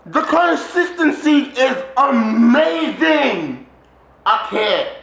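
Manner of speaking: high, loud, very slow marvel; ecstatic shout. Real, not acted. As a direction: stretch every word out in loud awe. Overjoyed by the consistency.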